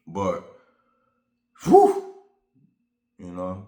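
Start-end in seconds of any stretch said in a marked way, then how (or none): 1.6-2.1 big high loud exhale of relief; loud relieved whew. Real, not acted. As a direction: blow it out, releasing tension. Exhale after venting.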